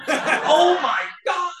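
imitating his thrilled, slow, high-pitched cry through a laugh; old coworker overjoyed that Chandler got sober, excited cry. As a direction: stretch out the delighted exclamation. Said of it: "He was so excited".

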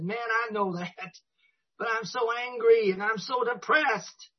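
high, strained mock whining; distressed protest. Newcomer on the phone to sponsor, exasperated and miserable. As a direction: imitate a worked-up plea, pitched well up. Retold desperate call to sponsor.

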